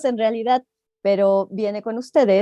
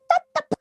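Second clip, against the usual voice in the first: high crash ta ta; much faster than usual. A narrator's reading. Rabbit crashing into tree.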